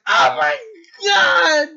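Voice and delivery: hammed-up, very loud high scream; overwhelmed fan shout. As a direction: re-enact losing it completely at the trailer. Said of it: "And I started crying".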